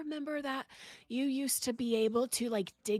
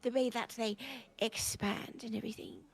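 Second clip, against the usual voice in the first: put-on smoker old lady voice; 3 semitones higher than usual. Friend improvises old lady smoker backstory in character. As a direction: play the chain-smoking old lady.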